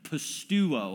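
pisteuo said clearly as PIS-teh-OO-oh, stress on the first syllable, falling at the end; Greek word demo. Shown as a demonstration. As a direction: sound out pisteuo for the room to repeat. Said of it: "Say it with me".

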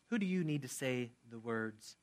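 ordinary talk, nothing marked

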